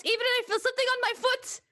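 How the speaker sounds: high alarmed voice; panicked. Acted. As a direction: yelp it in sudden alarm. Panicking about leeches.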